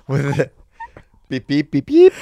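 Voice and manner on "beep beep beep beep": loud, higher-pitched run of beeps; imitated censor beep. Co-host covers the censored name, mock censor beeps. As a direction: mimic a bleep machine.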